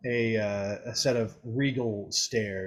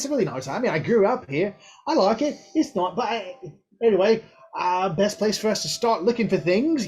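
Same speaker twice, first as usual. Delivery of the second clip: put-on much higher, chatty young elf voice; Nim's bright voice; 7 semitones higher, 4 dB louder than usual. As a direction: play Nim, high and eager. Nim's high voice at home.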